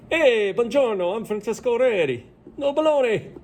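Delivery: high-pitched, hammed-up Italian accent; bad Italian accent. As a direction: play a cartoon Italian introducing himself. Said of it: "that was me in a bad Italian accent".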